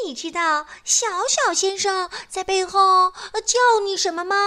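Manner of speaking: put-on mischievous girl stirring up trouble; sly Miss Trouble. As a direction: fish for trouble with a sweet voice. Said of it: "她问傲慢先生说".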